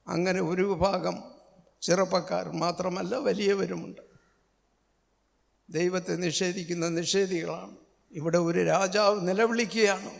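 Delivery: ordinary delivery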